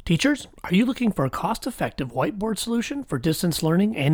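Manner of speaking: bright podcast ad voice; ad read. Acted. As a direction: sell it like a radio spot. Host reads sponsor ad.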